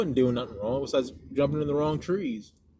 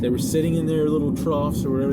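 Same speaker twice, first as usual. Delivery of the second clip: ordinary delivery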